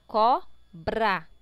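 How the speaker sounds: cobra in two loud pieces, co-bra; syllable by syllable. Shown as a demonstration. Teacher corrects the split for pupils, syllable by syllable. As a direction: slowly separate cobra into co and bra. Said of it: "todas as palavras estão separadas".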